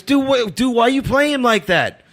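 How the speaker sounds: high-pitched, indignant imitation of the guy; accusing stranger. Stranger challenges him outside church, imitated high indignant voice. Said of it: "He goes".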